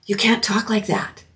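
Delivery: urgent hushed whisper, fading back toward voice; retold whisper. Ukrainian friend warning me on Main Street, whispered. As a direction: warn me under your breath. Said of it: "And she goes".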